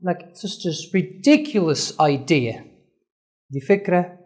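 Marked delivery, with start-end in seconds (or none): 0.0-2.6 very slow, "ridiculous" stressed. Shown as a demonstration. Teacher example sentence, slow stressed "ridiculous". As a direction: linger on DI in "ridiculous". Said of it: "عملت stress on".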